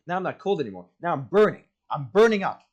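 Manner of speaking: mock panic that keeps climbing to a yell; panicked. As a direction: blurt it out as the burn hits. Panic over burning skin.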